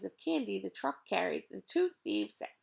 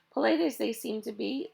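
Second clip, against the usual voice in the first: ordinary delivery, nothing marked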